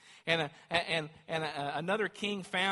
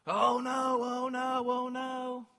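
high, loud sing-song "oh no" wail; hammed-up alarm as trouble hits; mock panic; 10 semitones higher, 8 dB louder, much slower than usual. Preacher playing a worried believer, mock panicked wail.

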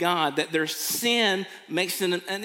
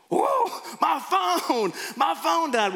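high, loud alarmed cry of whoa, my phone; panicked shock; 12 semitones higher, 4 dB louder, slower than usual. Acted. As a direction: jump up in pitch in sudden panic. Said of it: "It's gonna be like".

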